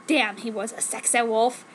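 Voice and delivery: plain reading voice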